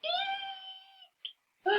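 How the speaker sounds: shrill very high squeal; a lion letting out a tiny high eek; lion's eek. A narrator's reading. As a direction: shriek like a frightened cat. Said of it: "let out an eek".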